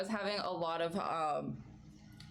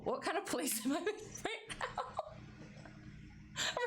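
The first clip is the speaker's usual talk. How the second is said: laughing; 11 semitones higher, 3 dB quieter than usual. Retold or imitated. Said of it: "And I was like".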